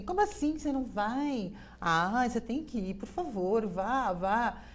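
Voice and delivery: high, pleading mock insistence; insistent friends. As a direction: pester them to come. Pessoas insistem. (amigos insistindo com voz aguda)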